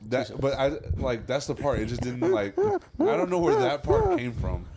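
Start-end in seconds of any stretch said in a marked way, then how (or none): 2.3-4.2 squeaky drawn-out whine; high whine. Acted.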